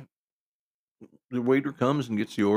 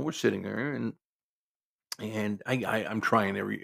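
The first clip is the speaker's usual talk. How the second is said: ordinary delivery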